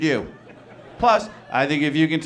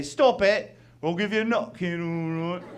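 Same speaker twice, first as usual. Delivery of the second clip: high cartoon British warning trailing into mumble; mock British threat; 3 semitones higher than usual. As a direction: imitate a Brit picking a fight. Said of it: "what they do is they say".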